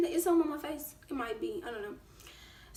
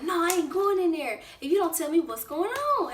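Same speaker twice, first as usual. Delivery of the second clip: put-on stubborn boyfriend; 5 semitones higher, 3 dB louder than usual. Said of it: "And he like".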